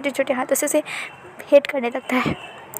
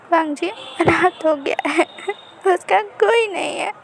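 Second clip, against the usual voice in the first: put-on sad child; 5 semitones higher, slower than usual. A narrator's reading. Child says he is orphaned.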